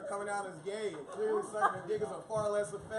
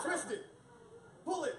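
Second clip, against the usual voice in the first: mock Bop It voice; 8 semitones higher than usual. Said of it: "I was like".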